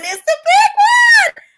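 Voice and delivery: hammy wail. Retold or imitated. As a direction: wail it like a heart attack. Second, louder hammed take.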